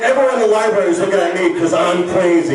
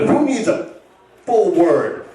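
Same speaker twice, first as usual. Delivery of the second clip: ordinary delivery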